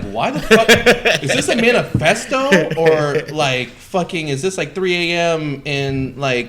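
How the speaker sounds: high, loud disbelief; baffled exclamation; incredulous. Retold or imitated. Incredulous retold reaction.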